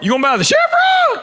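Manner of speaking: squeaky high disbelieving yelp; a stranger mocking the idea of buying the gas station; incredulous local. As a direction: shoot up into a stunned high voice. Local guy's incredulous question.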